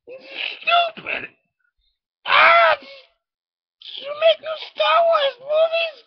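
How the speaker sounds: very high, loud, near-crying shriek; hysterical rant. Acted. A comic shrieking at the crowd about new Star Wars films, high and near-crying. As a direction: screech it like you're losing your mind.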